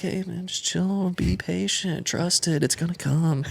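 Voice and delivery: soft, slow and lifted imitation of a laid-back dad; mellow, unhurried "relax, it'll work out" voice; super chill dad. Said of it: "my dad's super chill".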